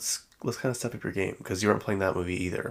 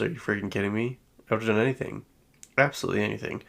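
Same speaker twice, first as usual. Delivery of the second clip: ordinary delivery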